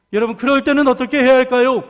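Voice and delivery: preacher pressing the congregation; stronger repeat. Acted. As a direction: push 어떻게 해야 할까요 harder. Question asked, then repeated stronger.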